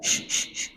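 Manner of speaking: whispered shh to shoo chickens; unvoiced shooing shh; shooing hiss. Shown as a demonstration. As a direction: hiss shh like chasing hens away. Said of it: "musuhnya ayam".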